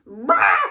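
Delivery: loud laughing mock roar; hammed-up monster bah; unicorn roar. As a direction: bark out the creature's roar. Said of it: "they're like".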